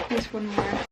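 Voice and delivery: ordinary talk, nothing marked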